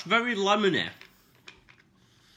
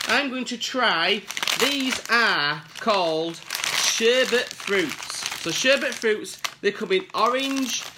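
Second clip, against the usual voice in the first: usual speaking voice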